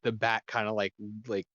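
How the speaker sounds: normal talking voice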